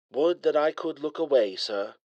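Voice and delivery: a dry English AI teasing his master; light English accent. A narrator's reading. Al dry reply.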